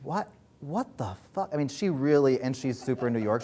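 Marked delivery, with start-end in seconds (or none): 0.0-1.5 stunned disbelief. Retold or imitated. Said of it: "she was like".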